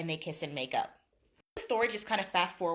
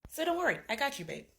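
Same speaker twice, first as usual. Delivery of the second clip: ordinary delivery, nothing marked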